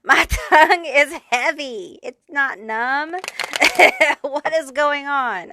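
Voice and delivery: laughing through the words; giggling at her own tongue. Real, not acted.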